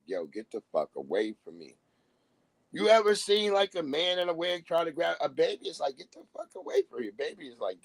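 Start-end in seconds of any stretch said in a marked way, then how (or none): 0.0-1.8 much lower, dropped mock baby warning. Baby warns off a stranger, low and soft. 6.0-7.1 much higher, fast squealing mock baby; panicked baby voice. Baby shoos a man in a wig, high and fast.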